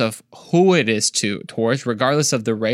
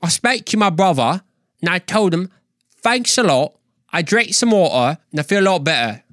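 higher, hammed-up Cockney; Cockney accent; 5 semitones higher than usual. Speaker showing he can do it, Cockney accent. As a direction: put on a playful Cockney British accent. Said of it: "that was some good Cockney accent".

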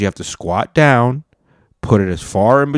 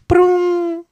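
very high, loud mock startup noise; charging sound; 5 dB louder than usual. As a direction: make a goofy electronic power-up sound. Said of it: "it'll just go".

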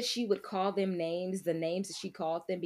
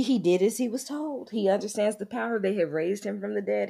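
ordinary talk

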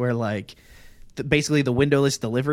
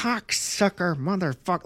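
cursing through his teeth; 5 semitones higher than usual. Retold or imitated. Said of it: "like, in his teeth".

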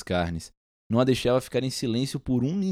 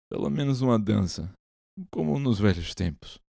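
put-on drunk boyish plea. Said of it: "Embriagado, o seu jeito de menino".

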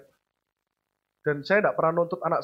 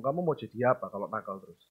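low, soft, heavy fatherly warning; a quiet rebuke that cuts deep; stern father; 9 semitones lower, 7 dB quieter than usual. Retold or imitated. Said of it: "kalau papa ngomong". (ayah menegur pelan, suara rendah)

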